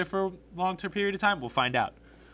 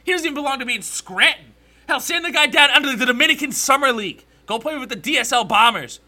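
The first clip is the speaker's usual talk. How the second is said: loud, higher-pitched exasperated tirade; angry rant; 6 semitones higher, 8 dB louder than usual. Real, not acted. Fan ranting about a reliever who should be off the roster, angry. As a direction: tear into the player, loud and worked up.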